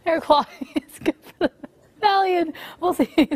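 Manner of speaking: giggling through the report; swallowing laughs mid-sentence; anchor stifling giggles. Real, not acted. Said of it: "We have the giggles this morning".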